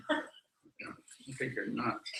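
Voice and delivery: lips barely moving, under the breath; ventriloquist. Shown as a demonstration. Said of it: "I would say it like a ventriloquist".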